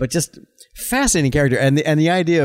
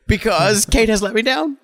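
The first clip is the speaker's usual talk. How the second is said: mock despair; 9 semitones higher than usual. Mock crisis of faith over actress.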